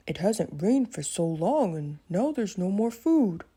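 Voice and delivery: lightly voiced tired Mickey; a hungry friend, a hint of weariness.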